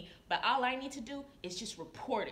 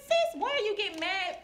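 mock indignant; 9 semitones higher, 6 dB louder than usual. Nurse mimicking her reply to angry coworkers, high and indignant. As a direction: throw it back at them, indignant and loud. Aides confronting her, about to fight.

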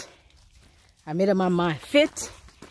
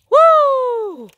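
very high, loud, long "woo"; loud winded whoop; 9 dB louder than usual. Real, not acted. Walker out of breath behind her dog, loud high woo. Puffing on an uphill walk.

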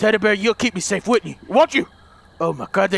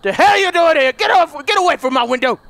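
shouting; 9 semitones higher, 5 dB louder than usual. Real, not acted. Monster at window.

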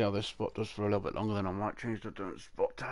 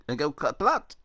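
louder, higher mock German punchline; German accent; 5 semitones higher, 6 dB louder than usual. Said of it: "It's very clearly a German accent".

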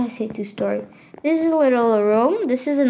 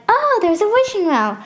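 high, mock-amazed visitor voice; pretend visitor; 10 semitones higher, 4 dB louder than usual. Player imitates people finding the fake wishing well, mock amazement. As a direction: put on a higher voice for an excited passer-by. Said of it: "People are gonna be like".

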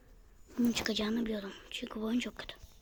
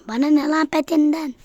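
irritated snap; 5 semitones higher, 11 dB louder than usual. Real, not acted. Annoyed at pet offer.

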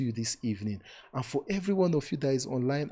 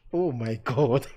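delighted exclaim; 3 semitones higher, 8 dB louder, slower than usual. Real, not acted. Reacting to mommy's comment.

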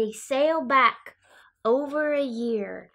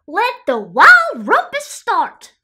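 high, loud, drawn-out cry; 8 semitones higher, 15 dB louder than usual. A narrator's reading. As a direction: proclaim the start of wild revels, stretching it out. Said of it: "cried Max".

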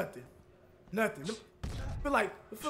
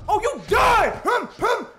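high, loud triumphant shout; excited shout; 9 semitones higher, 8 dB louder, slower than usual. Acted. Boxer knocking out his rival, loud triumphant shout. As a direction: yell in victory.

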